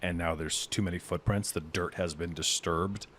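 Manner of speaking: normal talking voice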